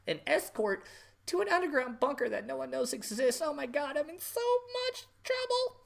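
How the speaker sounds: high, hammed-up sobbing politician voice; mock fake crying. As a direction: parody a melodramatic damsel in distress. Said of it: "have her fake cry and say".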